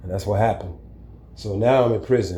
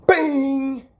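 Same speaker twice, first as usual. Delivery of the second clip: high sharp "Bing!" like a hit landing; punch sound effect. Retold or imitated. Retelling the fight, he voices the punch high. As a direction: spit out a cartoon punch sound.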